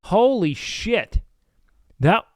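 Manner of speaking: usual speaking voice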